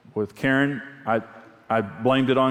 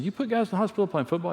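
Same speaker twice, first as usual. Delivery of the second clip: incredulous question; 8 semitones higher, faster than usual. Retold or imitated. His shocked question in counseling.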